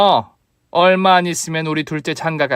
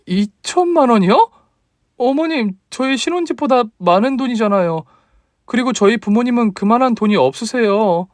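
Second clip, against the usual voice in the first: put-on much higher, startled young wife; higher voice of a stunned young bride; shocked daughter-in-law; 10 semitones higher than usual. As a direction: gasp at the sum, then plead.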